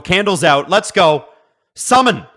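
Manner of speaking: rising mock ritual call; hammed-up summoning cry; playful summoning. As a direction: build up to a high, loud command.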